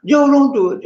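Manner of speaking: high, pleading wife's voice; desperate high plea to her husband. Retold or imitated. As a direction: beg Joe in a high voice. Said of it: "She said".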